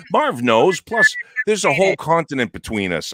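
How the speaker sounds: loud joking. Real, not acted. Joking loudly about work husband.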